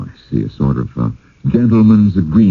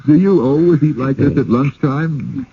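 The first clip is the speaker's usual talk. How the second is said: asked through a laugh, higher; laughing question; 8 semitones higher than usual. Real, not acted. [name] teasing the host about lunch, laughing. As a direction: tease him while still laughing.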